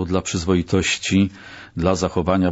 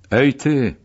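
yell; 5 semitones higher than usual. Acted. Section boss calls out a prisoner, yelling. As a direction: bark it out to single someone out. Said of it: "wrzasnął".

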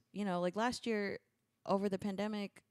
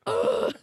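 loud, high ugh of frustration; fed up with her parents' questions, loud high groan; exasperated groan; 13 dB louder than usual. Retold or imitated. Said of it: "I'm like".

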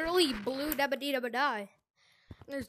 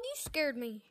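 ordinary talk, nothing marked

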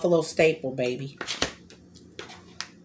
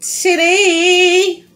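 high, slow dramatic announcement; mock announcement; 10 semitones higher, 4 dB louder, much slower than usual. Real, not acted. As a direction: announce it like breaking news. Announcing her choking mishap.

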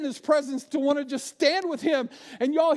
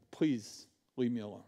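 much softer, lower, worn-down plea; weary plea; 13 semitones lower, 10 dB quieter than usual. Acted. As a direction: sigh it out like you've nothing left. Weary person pushing people away.

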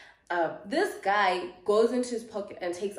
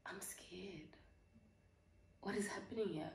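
hushed, frightened aside; scared whisper; 16 dB quieter than usual. Retold or imitated. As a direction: drop to a tiny, fearful whisper. Retold frightened aside after abusive calls.

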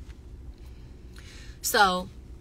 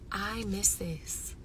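retold wistful realization; 6 dB quieter, much slower than usual. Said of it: "and I was like".

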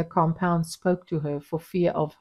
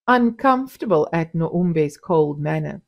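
plain reading voice

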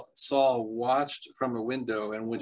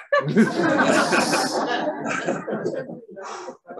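breathless laugh. Real, not acted. Out of breath after reading.

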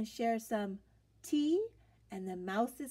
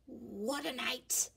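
muttering mole; 3 dB quieter, slower than usual. A narrator's reading. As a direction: grumble it under your breath, slowly. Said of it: "Mole mutters".

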